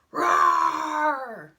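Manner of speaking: big, loud lion roar; high, drawn-out storyteller's roar. Acted. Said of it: "the lion jumped out".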